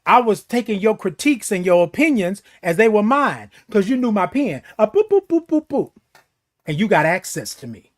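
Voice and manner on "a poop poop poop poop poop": high playful poop poop; keypad tones. Retold or imitated. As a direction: tap out a code in high chirps.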